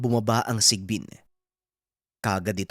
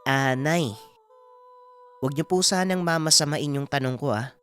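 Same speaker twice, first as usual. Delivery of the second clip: hesitant son, voice pitched higher; Aming; 7 semitones higher than usual. Acted. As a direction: carefully ask mother a delicate question. (nag-aalangang anak na magalang magtanong, mataas ang tono)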